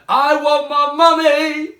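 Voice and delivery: Little Mug wails in fright; frightened wail. Acted. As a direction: cry out for mummy like a scared child. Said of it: "yelled Little Mug".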